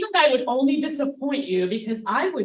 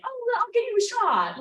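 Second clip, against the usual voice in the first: high-pitched, impulsive offer; eager offer; 10 semitones higher than usual. Retold or imitated. Offering her date a drink, eagerly. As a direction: jump in with a high, keen offer.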